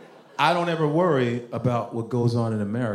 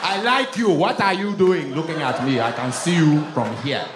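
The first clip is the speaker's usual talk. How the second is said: put-on Nigerian accent talking crazy; 3 semitones higher, 3 dB louder than usual. Cousins talking crazy to women, bossy Nigerian accent. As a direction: order her over in a Nigerian accent. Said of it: "they would talk to women crazy".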